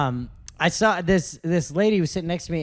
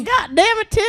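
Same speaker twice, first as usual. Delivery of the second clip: a much higher mock mom voice; imitating Tim's mom; 4 dB louder than usual. Friend mimicking Tim's mom cursing at Tim, high-pitched. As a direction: pitch way up like his mother swearing. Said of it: "Patty cakes, will you send me a voice note".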